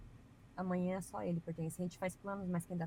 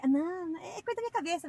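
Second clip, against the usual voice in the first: mock dismissal; 10 semitones higher, 3 dB louder than usual. Mocking a stubborn believer.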